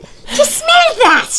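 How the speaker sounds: very high, slow, suspicious granny sniffing; Scottish grandmother. Retold or imitated. Grandmother asks Kathy about a smell, slow high Scots voice. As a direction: draw it out slowly, up high, like a nosy Scots gran. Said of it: "and she said".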